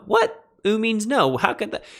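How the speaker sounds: high squeaky mock what, puzzled; mock surprise. Teacher voicing a confused student, high and incredulous. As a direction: play the baffled student.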